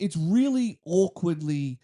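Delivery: ordinary delivery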